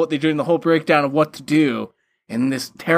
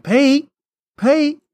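calling out; 10 semitones higher, 4 dB louder than usual. Retold or imitated. Retold calling out to Pete.

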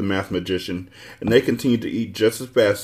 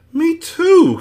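much higher and louder whine; complaining; 9 dB louder than usual. Acted. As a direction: complain it loudly, high. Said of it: "complained Milo".

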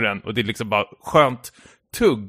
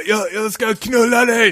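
high-pitched mock yell of a threat; raised, worked-up retold outburst; 9 semitones higher, 3 dB louder than usual. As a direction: act out an enraged man's threat. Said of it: "skriker han".

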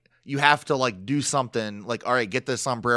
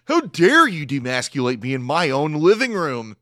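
hammed-up indignation with swooping pitch; theatrical fake offense; mock outrage; 3 semitones higher, 4 dB louder than usual. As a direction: play the wounded, affronted man for laughs. Acting out Vinny's wounded outrage.

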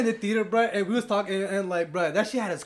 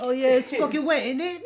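mock British; 5 semitones higher, 4 dB louder than usual. British parody.

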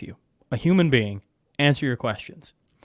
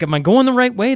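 high, louder mock confusion, like a lost traveller; 8 semitones higher, 6 dB louder than usual. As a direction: play the bewildered tourist asking aloud. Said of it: "everyone's just kinda like".

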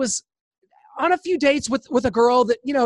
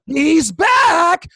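high, loud horror-movie call; mock-ominous shout; dramatic announcement; 8 semitones higher, 7 dB louder than usual. Announces the gunman's return, dramatically.

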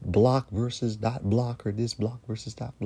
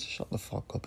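breathy, whispered shut the fuck up; hissed dismissal; 5 semitones lower, 10 dB quieter than usual. Real, not acted. Host dismisses critics who call media outlets hypocrites, hissed whisper. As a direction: hiss it at the critics under your breath.

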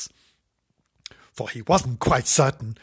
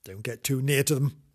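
ordinary delivery

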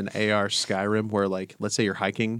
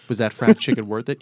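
usual speaking voice

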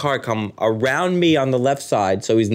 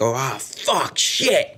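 1 loser's groan; 2 semitones higher, much slower than usual. Retold or imitated. Gambler losing everything.

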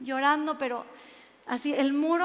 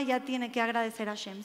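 ordinary talk, nothing marked

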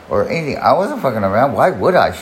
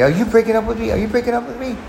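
retold screaming; 13 semitones higher, faster than usual. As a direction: imitate her frantic screech at a held high pitch. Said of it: "she's screaming".

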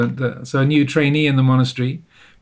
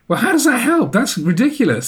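higher-pitched, incredulous protest; mock indignation; 8 semitones higher than usual. Hungry young meditator doubting the teaching, retold with mock indignation. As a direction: scoff at the idea, pitch jumping up. Acting out his scornful first reaction.

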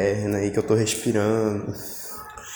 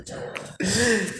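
short high laugh; 10 semitones higher, 4 dB quieter than usual. Real, not acted. Laughs mid-list of falls.

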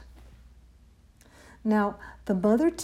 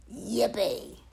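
rough-voiced yippee; cried-out cheer. A narrator's reading. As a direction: cheer in a creature's put-on voice. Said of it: "And the T's cried out".

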